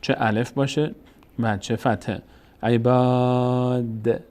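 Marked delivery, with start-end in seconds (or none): 2.6-4.2 عباد drawn out long, /æjbade/ with a stretched a; تلاوت کشیده عباد; slow Quranic reading. Shown as a demonstration. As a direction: chant عباد slowly, holding the long aa. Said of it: "میچسبه به دال".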